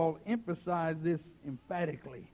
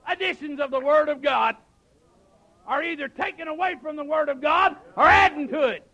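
raised, emphatic pulpit delivery; full-force preaching; 8 semitones higher, 13 dB louder than usual. Acted. Preacher denouncing revised editions of God's Word, full-force preaching. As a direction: hammer out each phrase between pauses.

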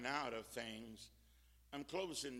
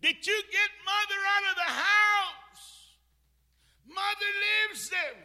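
frantic shout; 15 dB louder than usual. Retold or imitated. Daughter at the burning house asking after Mother, frantic shout. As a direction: shout it out in desperate alarm, far above usual pitch. Daughter's cry retold at fire.

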